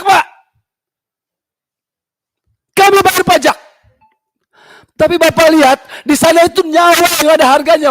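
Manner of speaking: real furious shouting, high and loud; impassioned protest shout; emotional outburst. Real, not acted. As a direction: let all the anger out at full voice. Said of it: "biar saya lepas, Pak, emosi saya".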